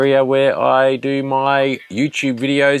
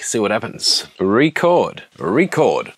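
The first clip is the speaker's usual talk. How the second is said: usual speaking voice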